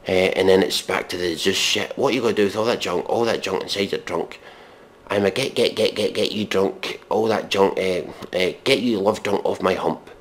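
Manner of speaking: ordinary talk, nothing marked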